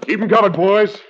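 outlaw leader barking orders to his gang; calling out. Acted. Outlaw chief calling to his gang, loud and high. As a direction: shout the orders across to the boys.

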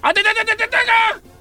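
high, garbled rapid-fire crowd call; mock a colleague's jumbled shout-out; imitating el chino. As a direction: unintelligible hammed-up host yell. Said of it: "El chino dice, el chino habla".

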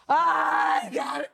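put-on slurred, tipsy cry of a drunk girl; mock drunk. Telling friends how one cigarette got her drunk, mock drunk wail. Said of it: "borracha de".

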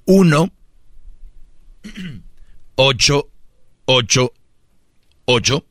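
one digit at a time, long pauses between; mockingly slow dictation. Shown as a demonstration. Host dictating the number for slow-minded critics, very slowly. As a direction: spell it out as if for slow learners. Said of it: "voy a dar el número despacito".

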